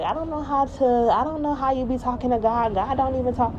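high, doubtful skeptic; doubting skeptic voice. Retold or imitated. Mimicking a doubter who says God doesn't talk. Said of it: "some people be like".

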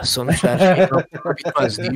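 normal talking voice

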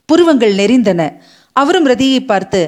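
ordinary delivery, nothing marked

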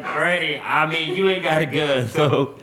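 imitated sheepish protest; pleading excuse. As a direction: reason with granny, playfully pleading. Child reasoning with granny.

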